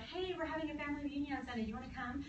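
fast, airy and soft; a sugary invite, rushed and light; mock cheery cousin. Cousin mock-inviting family to the reunion, fast and soft. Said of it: "not like the happy cousins".

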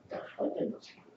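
whispered repeat of the question to the bird; hushed whisper to the bird. Real, not acted.

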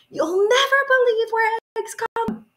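put-on high, slowed, wonder-filled child voice; excited little Jane. Little Jane telling Mom about the eggs, high excited voice. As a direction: pitch way up and marvel at the discovery.

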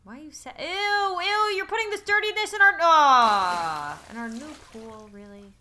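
squealing ew, then deflating; disgusted at the dirty pool; grossed out. Real, not acted. Freddy dirties the new pool.

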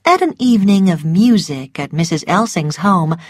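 usual speaking voice

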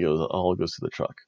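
usual speaking voice

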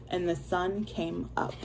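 ordinary delivery, nothing marked

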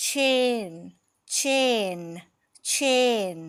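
"chain" loud and long, pitch falling each time; stressed long A. Shown as a demonstration. Teacher drills the stressed first syllable, stressed long A. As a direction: punch out "chain" with a drawn-out AY, three separate times. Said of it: "คำนี้จะเป็นเสียง".

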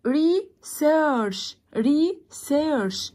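"ريسيرش" slowly broken as ree, search; two split syllables. Shown as a demonstration. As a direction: pause inside each "ريسيرش" after ree. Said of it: "الكلمات اللي على مقطعين". ("ريسيرش" ببطء ري ثم سيرش)

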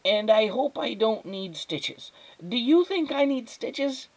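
put-on precocious child, high and fussy; small, high, worried voice; high, thin boy's voice. A narrator's reading. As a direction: explain your injury with earnest drama. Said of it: "His voice was high and thin".

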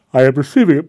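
said through a loud yawn, voice pulled high; words stretched by a sudden yawn. Real, not acted. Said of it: "Oh, pardon me for the yawn".

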